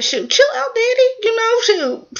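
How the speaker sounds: very high-pitched imitated protest; mock protest. Son telling his daddy to chill out, high mock protest. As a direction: squeak it out as an acted quote. Acted reply of son to father.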